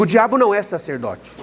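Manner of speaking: declaration for the church to repeat. Shown as a demonstration. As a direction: lead them in a bold proclamation. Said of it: "diga assim comigo".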